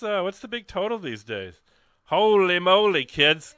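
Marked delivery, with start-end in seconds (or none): 2.1-3.5 loud, high, delighted shout; excited exclamation. Real, not acted. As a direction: burst out thrilled at the total. Host thrilled by pledge total.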